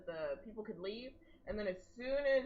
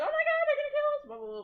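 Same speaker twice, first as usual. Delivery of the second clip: much higher, louder, fast mock panic; 10 dB louder, much faster than usual. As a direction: squeal it like a panicking crowd. Voicing the panicking Hebrews.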